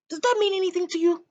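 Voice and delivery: high quick odd question; eccentric old man. Acted. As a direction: fire it off high and fast.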